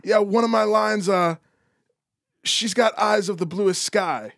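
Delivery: put-on high, slow old Portuguese man; old Portuguese accent. Old Portuguese songwriter showing off his line, accented. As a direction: recite your line proudly in a Portuguese accent. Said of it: "doing a perfect accent".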